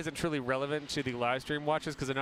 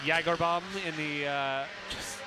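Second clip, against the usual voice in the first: usual speaking voice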